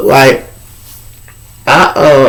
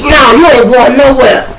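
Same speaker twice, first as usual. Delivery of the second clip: higher, louder flat refusal; blocking threat; 10 semitones higher, 4 dB louder than usual. Retold or imitated. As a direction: plant yourself in the doorway and refuse. Inmate blocking the door.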